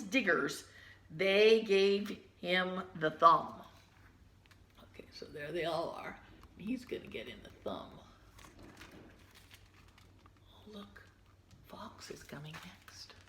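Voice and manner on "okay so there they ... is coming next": whisper. Real, not acted.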